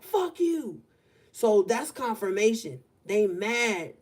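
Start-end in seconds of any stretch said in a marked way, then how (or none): 0.0-0.8 mock angry "Fuck you," pitched higher; retold cussing. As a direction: imitate the neighbor cursing someone out, half-hearted. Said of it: "somebody outside just cussed at somebody".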